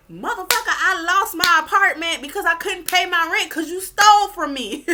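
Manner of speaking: enraged victim confronting a thief, loud and high; furious retort. Retold or imitated. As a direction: yell it at the one who robbed you. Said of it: "you're just like".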